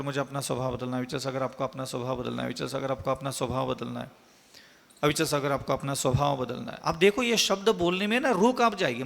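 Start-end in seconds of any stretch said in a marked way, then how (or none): 0.0-1.4 fast, soft muttering under the breath; muttered mantra. Retold or imitated. Teacher reenacting his daily self-vow, muttered fast and soft. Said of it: "यही बड़बड़ाता रहता था". 1.4-6.8 fast, soft repeated muttering; muttered mantra. Retold or imitated. Preacher reciting his old self-vow, fast soft muttering. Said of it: "यही बड़बड़ाता रहता था".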